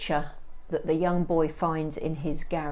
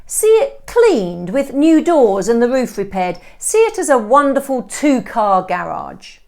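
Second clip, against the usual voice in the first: louder, higher salesman's pitch; pushy estate agent; 6 semitones higher, 12 dB louder than usual. Acted. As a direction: sell the wreck like a dream home. Estate agent Stone voiced.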